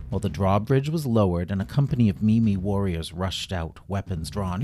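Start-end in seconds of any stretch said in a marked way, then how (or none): none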